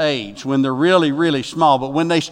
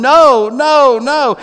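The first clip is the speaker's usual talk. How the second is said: loud, much higher cries of no; mock hollering; 10 semitones higher, 8 dB louder, much slower than usual. Preacher telling of the third dad fleeing the waiting room, mock hollering. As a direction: imitate a man hollering in panic, high and loud. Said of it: "just screaming and hollering".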